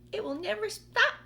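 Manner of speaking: high, loud cry of a greedy aunt; shouting aunt. Acted. Aunt Sponge dancing round the tree, high loud shout. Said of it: "Aunt Sponge shouted".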